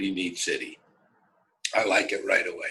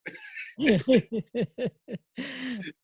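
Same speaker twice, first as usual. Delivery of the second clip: high, hearty burst of laughter; a retold high laugh; big laugh; 12 semitones higher than usual. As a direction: laugh it up as the guys. Guys laugh at his remark.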